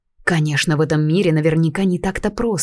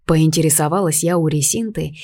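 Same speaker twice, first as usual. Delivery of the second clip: ordinary delivery, nothing marked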